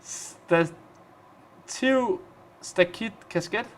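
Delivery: Stativ, stakit, kasket said crisply in Danish; Danish word demo. Shown as a demonstration. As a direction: showcase Danish sounds one word at a time. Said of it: "to do all the lo".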